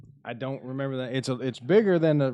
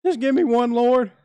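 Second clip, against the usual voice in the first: high pleading cry to the Lord; mock prayer; 12 semitones higher, 6 dB louder than usual. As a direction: beg the heavens, high and loud.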